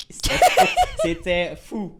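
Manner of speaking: talking through a real laugh. Real, not acted. Host recalls the crazy reaction to the video, laughing.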